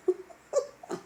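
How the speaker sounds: breathy, voiceless laugh; real laugh. Real, not acted. Vlogger laughing because she doesn't want to cry, breathy laugh. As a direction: laugh it off to keep from crying. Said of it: "I'm laughing because".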